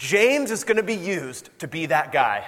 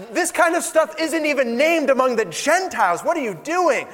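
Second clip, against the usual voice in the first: lightly voiced Paul rebuking; 6 semitones higher than usual.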